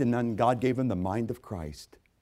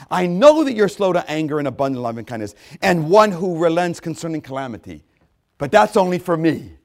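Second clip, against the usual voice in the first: high, loud, indignant Jonah pleading; prejudiced Jonah; 6 semitones higher, 8 dB louder than usual. Acted. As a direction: throw Jonah's complaint at God. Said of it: "listen to this prejudice".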